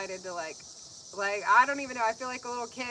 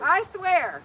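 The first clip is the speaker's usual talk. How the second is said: high, loud emphatic exclamation; loud, pitched-up gush; real gushing; 8 semitones higher, 10 dB louder than usual. Real, not acted. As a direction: burst out with heartfelt insistence. Gushing over the gift giver.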